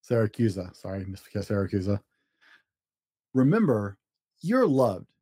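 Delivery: usual speaking voice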